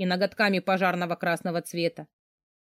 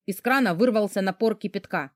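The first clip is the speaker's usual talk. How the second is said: ordinary delivery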